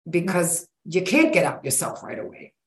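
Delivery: ordinary delivery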